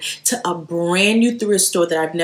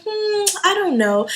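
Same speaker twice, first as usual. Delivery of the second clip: higher, musing "hmm"; doubtful inner voice; 12 semitones higher than usual. Retold or imitated. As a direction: play back your doubtful first thought. Retold doubtful first thought.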